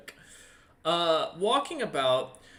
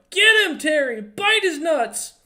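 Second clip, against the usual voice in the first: very high, loud, slow yell; shouting at dog; 14 semitones higher, 11 dB louder than usual. Acted. Shopkeeper urging his terrier, high loud yell. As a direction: sic the dog on him. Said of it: "the old man go".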